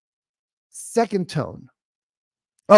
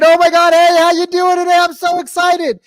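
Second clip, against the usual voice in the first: loud, high telemarketer squeal; super excited; 10 dB louder than usual. Shown as a demonstration. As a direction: blast the prospect with over-the-top excitement. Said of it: "And you're super excited".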